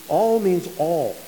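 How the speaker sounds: each "all" stressed hard, slowly; All means all, slow and hammered. Shown as a demonstration. Preacher insisting to his church that "All means all", slow and heavy. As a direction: drive home "All" word by word. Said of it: "when you read in your Bible all".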